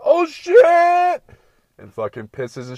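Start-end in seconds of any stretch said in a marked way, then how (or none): 0.0-1.2 shrieking, very high and loud mock yelp; panicked dog. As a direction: yelp it like a terrified little dog. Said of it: "the dog's like".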